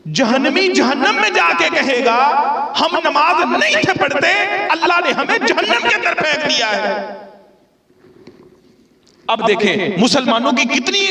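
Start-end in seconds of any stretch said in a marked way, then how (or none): 2.8-6.9 higher-pitched voice of the condemned admitting it; dramatized confession. Acted. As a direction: play the damned soul confessing, lifted and pleading. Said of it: "ये सीधा तर्जुमा है, तशरीह नहीं की थी". (जहन्नमी का इकरार, ऊँची आवाज़ में)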